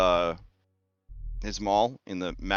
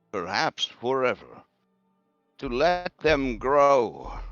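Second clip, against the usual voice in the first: raised, solemn prophetess; seer woman voice; 6 semitones higher than usual. Acted. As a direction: speak prophecy, slow and grave. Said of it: "Dula says".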